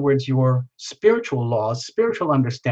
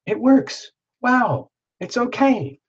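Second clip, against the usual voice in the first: much higher, wide-eyed wonder; amazed realization; 10 semitones higher, slower than usual. Pretend. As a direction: voice leaps up in delighted surprise. Acting out a sudden realization.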